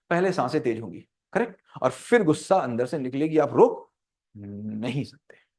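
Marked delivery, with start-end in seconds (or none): 4.4-5.5 slow, low whispered verdict; hushed drawn-out answer. Shown as a demonstration. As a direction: stretch it out in a low hush. Dramatic answer that it can't be stopped.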